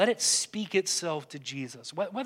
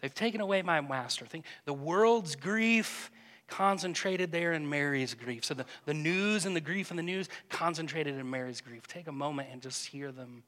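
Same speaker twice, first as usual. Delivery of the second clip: soft, hushed meditation voice, trailing very quiet; gentle contemplative prayer guide; guided meditation; 4 semitones lower, 4 dB quieter than usual. Acted. Leads a silent imaginative prayer.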